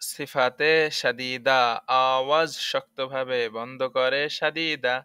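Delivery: slow sing-song rule recital; slow drilled rule. Shown as a demonstration. Teacher shows students how to recite it slowly. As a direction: spell it out slowly for repeating. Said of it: "teacher drills the rule rhyme".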